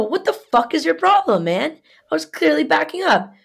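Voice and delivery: high-pitched exasperated protest; heated re-enacted argument; retold freak-out. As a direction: challenge him, voice jumping up. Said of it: "I'm freaking out".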